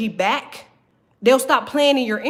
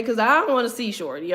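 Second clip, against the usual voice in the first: ordinary talk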